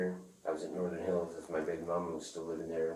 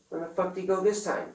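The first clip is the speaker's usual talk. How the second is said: retold angry yell; 13 semitones higher, 8 dB louder than usual. Retold confronting the dealer.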